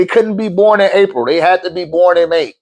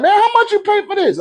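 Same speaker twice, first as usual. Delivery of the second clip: shocked homeboy. Retold or imitated. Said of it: "My homeboy is like".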